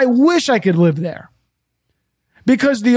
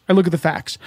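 ordinary delivery

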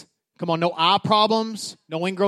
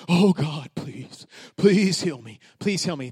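mock pleading prayer; 2 semitones lower, slower than usual. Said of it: "don't pray like this".

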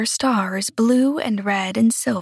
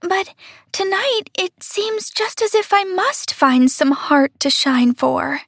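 put-on high, longing child voice; yearning, small and earnest; little star voice; 9 semitones higher than usual. A narrator's reading. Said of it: "said the star".

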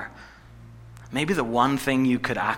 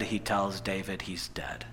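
low, near-whispered delivery; hushed; 5 semitones lower, 11 dB quieter than usual. A narrator's reading. Preacher revealing the son's death to listeners, hushed. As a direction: drop to a grave hush.

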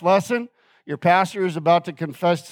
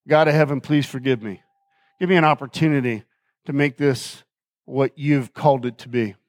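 humble prayer; 5 semitones lower, faster than usual. Retold or imitated. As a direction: pray it low and humbly. Retold prayer for forgiveness.

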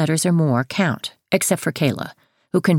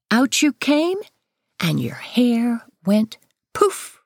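lightly higher tender storytelling; bedtime story; 5 semitones higher than usual. A narrator's reading. Bedtime story told to daughter.

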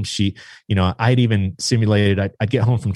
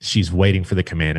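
ordinary talk

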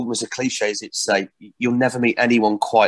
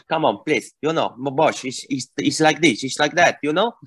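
a hammed-up Spanish waiter accent, pleading; pleading in a comic Spanish accent to the boss; Spanish barman caricature; 4 semitones higher than usual. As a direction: play the Manuel-style Spanish barman begging. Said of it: "um, caricature".

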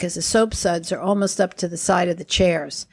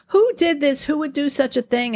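lightly voiced annoyed teacher; 6 semitones higher than usual. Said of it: "she was like".

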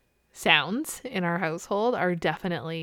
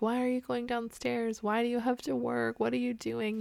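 put-on higher, softer child-like questions; imitated pestering kids; 4 semitones higher, 4 dB quieter than usual. Mom imitating her kids as she heads downstairs, pestering questions. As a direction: mimic kids pleading in a higher, lighter voice.

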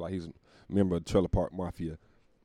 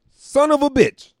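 much higher, louder, slow exasperated yell; frustrated curse; 13 dB louder, slower than usual. Retold or imitated. Comic reliving finding the wrong gig time, loud exasperated cry. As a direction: let it burst out in disbelief.

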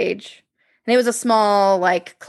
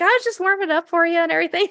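high, quick chirpy excuse; mock breezy excuse; 8 semitones higher, faster than usual. Telling the speaker she was warming up, high and fast.

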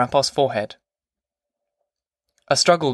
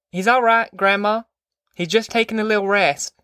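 higher-pitched woman soothing Grandma; pitched-up, reassuring character voice; reassuring mother; 8 semitones higher than usual. A narrator's reading. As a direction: call out higher that all is well. Ma reassures Grandma through the tent.

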